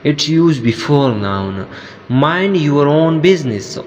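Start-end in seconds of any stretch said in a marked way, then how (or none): none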